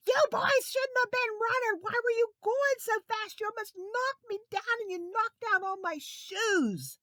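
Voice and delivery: high, loud scolding; angry lady. Acted. Lady scolding the running boys, angry. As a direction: scold the boys who knocked you over. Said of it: "she was angry".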